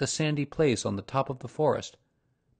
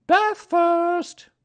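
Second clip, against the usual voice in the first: very high, loud mother kangaroo; cheerful high-pitched motherly order; 10 dB louder than usual. A narrator's reading. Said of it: "in a cheerful voice".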